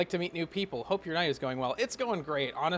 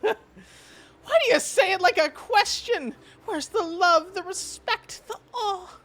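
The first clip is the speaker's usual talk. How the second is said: higher, louder theatrical hurt; indignant fairy; 10 semitones higher, 7 dB louder than usual. Acted. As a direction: demand affection, hammed-up.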